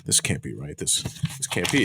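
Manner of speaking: hushed, low, faltering pretend shock; mock disbelief. Acting out flipping through released files, hushed mock disbelief. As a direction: play stunned, barely voicing it.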